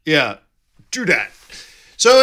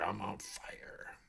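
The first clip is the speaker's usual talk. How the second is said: slow whisper to himself; 8 semitones lower, 23 dB quieter, slower than usual. Real, not acted. Streamer jokes that his buzzing phone is on fire, slow whisper. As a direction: breathe it out as a slow secret.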